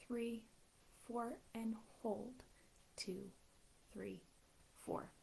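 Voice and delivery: soft, steady breathing count; guided breathing. Acted. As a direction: calmly count them through each breath. Leads box breathing counts.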